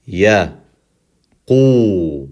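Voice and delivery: Ya and Qu apart, with long Qu; letter by letter. Shown as a demonstration. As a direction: isolate Ya, pause, then stretch Qu. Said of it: "Take it letter by letter".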